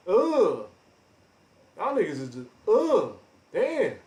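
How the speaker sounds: high, disgusted groans of a friend. Retold or imitated. Mimicking his Tekken friend scoffing at rivals, disgusted. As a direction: scoff at them like you can't stand the sight. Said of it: "He be like".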